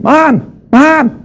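high-pitched mock call of Mom; mock scream. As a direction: call out as if shouting to mom from afar. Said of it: "Started screaming".

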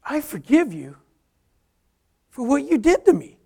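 high, strained emotional declaration; choked-up forgiveness. Retold or imitated. Emotional forgiveness of abusive father.

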